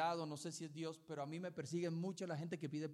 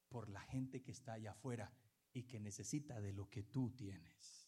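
gentle voice of God; 5 semitones lower, 4 dB quieter than usual. Retold or imitated. God correcting the pastor about true faith, gentle lower tone. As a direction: teach him tenderly, like a father. God speaking to him, retold.

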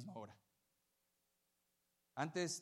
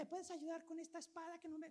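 put-on much higher, softer child's request; little girl voice; 12 semitones higher, 6 dB quieter than usual. Pastor voicing the girl asking for help, childlike. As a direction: ask sweetly like a small child.

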